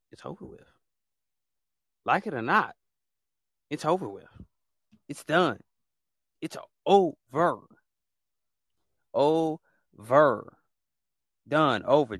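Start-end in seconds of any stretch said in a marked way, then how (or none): 0.1-0.7 hushed, breathless whisper. Real, not acted. Host ends drama, repeats line. 5.1-12.2 loud, final, clipped declarations; fed-up shouting. Real, not acted. Host fed up with trolls.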